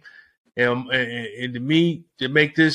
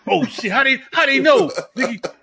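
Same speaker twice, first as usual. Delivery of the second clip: panicked; 9 semitones higher than usual. Retold or imitated. Retold panicked reaction to livestream.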